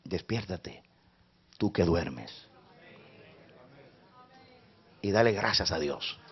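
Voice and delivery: low, quiet pleading over music; hushed prayerful appeal; soft altar call. Acted. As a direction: draw the listener in gently.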